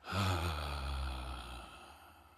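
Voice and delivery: long audible sigh out; voiced sigh. Shown as a demonstration. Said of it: "letting my voice come out a little bit in the".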